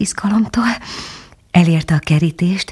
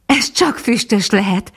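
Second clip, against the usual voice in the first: happy call; 5 semitones higher than usual. A narrator's reading. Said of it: "kiáltott fel asszonyka boldogan".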